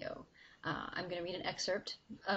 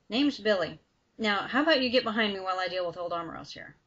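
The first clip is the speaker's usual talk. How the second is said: louder, brash hero stepping up; confident call; 9 dB louder, faster than usual. Acted. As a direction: announce yourself like you own the room. Billy steps in to fight.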